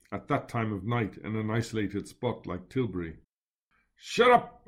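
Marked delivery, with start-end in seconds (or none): 4.0-4.6 sharp loud bark, pitched high; snapped command to nervous companions. Acted. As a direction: bark it to silence them. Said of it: "I barked".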